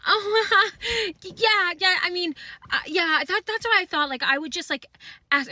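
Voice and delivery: high, rattled little voice, mocking her; squeaky imitation of a nervous shopper; nervous flustered girl. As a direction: play the caught girl stammering an excuse. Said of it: "with fear in her eyes".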